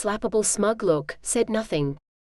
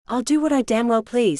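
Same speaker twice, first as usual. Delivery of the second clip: snapping; 3 semitones higher than usual. A narrator's reading. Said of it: "snapped James the Strong".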